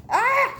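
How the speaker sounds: high, loud sudden yell; startled shout. Acted. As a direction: burst out with a yelp.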